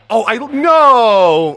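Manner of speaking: high, loud, drawn-out dismayed cry; shocked shout. Real, not acted. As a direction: cry out as you slip. Falls off the level.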